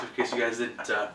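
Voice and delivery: normal talking voice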